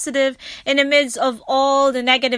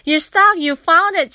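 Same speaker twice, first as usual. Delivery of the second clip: high, louder, protesting politely; flustered refusal; 7 semitones higher, 4 dB louder than usual. Retold or imitated. Narrator refusing stranger's gift, high and flustered.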